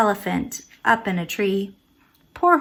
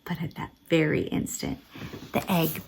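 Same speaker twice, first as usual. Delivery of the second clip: suspense whisper; 4 semitones lower, 10 dB quieter than usual. Acted. Suspense before hatching.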